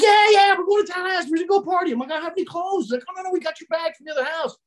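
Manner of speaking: high, upbeat party pitch; hyped buddy. Retold or imitated. Scott hyping up Jimmy about the party, high excited voice. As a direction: sell the road trip with glee.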